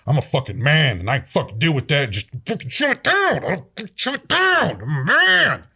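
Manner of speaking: tough-guy posturing with swooping pitch; hammed-up stoic dude shutting feelings down; mock macho man. Man mocking dudes who refuse to admit damage, mock macho. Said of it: "a lot of the dudes just refuse to acknowledge it".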